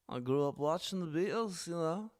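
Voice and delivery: a hint of British, just playing along; understated British accent; mock British accent. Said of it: "The British accent".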